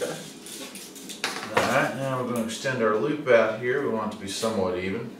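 usual speaking voice

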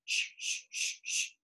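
whispered swishing water sound. Acted. Swimming sound effect.

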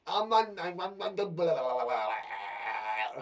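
starter dissolving into blah-blah babble; tumbling nonsense babble, sinking in pitch; babbling blahs. Shown as a demonstration. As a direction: let the words run down into a low, drawn-out blah. Babbles past the sentence starter.

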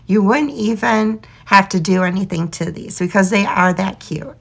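normal talking voice

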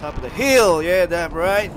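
loud triumphant shout; hyped yell. Real, not acted. Hyped after a kill.

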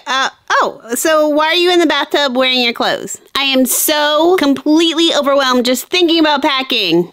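ordinary delivery

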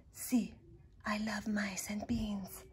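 slow, soft whisper. A narrator's reading. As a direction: breathe the answer shyly. Shy dog whispers answer.

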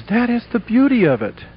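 lifted, lightly mock-wistful preacher. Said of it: "the preacher wistfully says".

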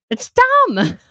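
retold frustration at a YouTuber, high and loud; exasperated outburst. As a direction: burst out in disbelief at the rumor. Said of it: "I just get frustrated".

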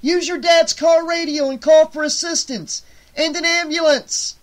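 put-on young man shouting instructions to Megan; urgent shout. A narrator's reading. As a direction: shout orders to get help now. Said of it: "he shouted".

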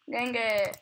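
ordinary talk

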